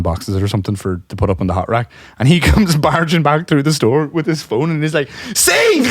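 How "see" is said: high-pitched, crowing retort; imitated triumph. Coworker barging back with his phone, triumphant. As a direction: burst in crowing with mock vindication.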